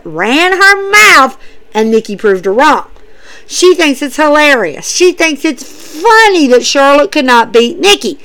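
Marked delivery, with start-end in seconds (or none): none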